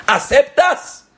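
very high, slow, loud demand; demanding question. Acted. Sage asking the mother to accept, high and slow. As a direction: press her for a yes.

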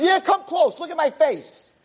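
high-pitched cranky demand; indignant storyteller's challenge; acted challenge. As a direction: dare him up high like a bitter old man. Old man challenges roommate.

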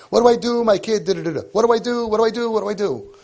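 high, loud frantic "What do I do?"; mock panicked parent. Parent phoning the rabbi in a panic, mock frantic. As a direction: ham up a caller's panic. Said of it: "I get calls from people".

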